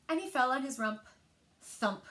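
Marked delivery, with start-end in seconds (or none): none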